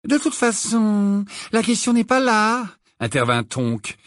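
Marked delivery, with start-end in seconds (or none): 0.1-2.7 put-on young witch voice.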